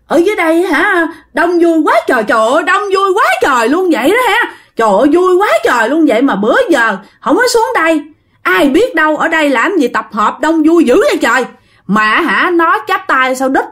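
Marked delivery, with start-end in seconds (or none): 0.1-11.4 high, singsong marvelling at a crowd; delighted exclaiming. Acted. Possessed child chats gleefully with unseen crowd, excited. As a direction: gush in a raised pitch, thrilled by the company. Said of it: "mà nó cười".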